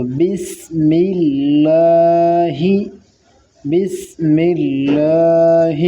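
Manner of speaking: slow بسم اللہ with a thin light ba and a clear meem; tajweed recitation. Shown as a demonstration. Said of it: "با کو ذرا باریک کر کے پڑھیں". (باریک با کے ساتھ بسم اللہ)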